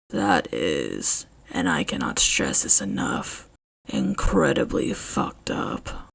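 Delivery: low, soft, slow whisper holding back anger; low restrained whisper. Acted. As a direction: contain the fury in a hushed voice. Eraserhead barely holding rage.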